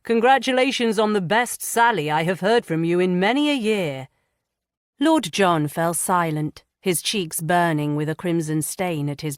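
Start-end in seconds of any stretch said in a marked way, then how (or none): 0.0-4.1 teasing grin. A narrator's reading. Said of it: "with a flash of a grin".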